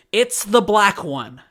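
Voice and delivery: slow, loud, high-pitched exasperation; hostile customer. Retold or imitated. Customer telling clerk which car is hers, hostile. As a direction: spell it out as if to an idiot. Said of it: "She is hostile with me".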